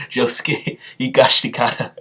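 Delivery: Higashikata repeated through real laughter, syllable by syllable; retry the botched name Higashikata while cracking up; laughing retry. Real, not acted. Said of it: "I said it so badly".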